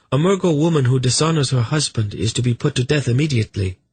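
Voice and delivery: proud, stern declaration. Acted. Mother demands her own execution.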